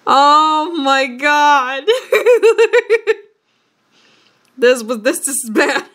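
loud groaning through real laughter; helpless, embarrassed laughing; laughing despair. Real, not acted. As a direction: groan and crack up at how bad it is. Reader laughing at own bad writing.

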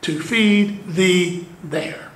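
slowly, weighting "feed" and "there"; slow emphatic quote. Shown as a demonstration. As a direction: spell out "To feed thee there." word by word. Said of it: "add it the second time for emphasis".

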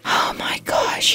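soft breathy oh my gosh; exasperated whisper to the viewer; whispered aside. Real, not acted. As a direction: whisper in disbelief. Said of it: "whispered aside".

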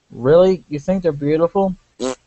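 normal talking voice

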